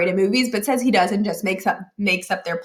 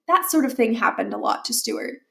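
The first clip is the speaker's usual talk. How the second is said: usual speaking voice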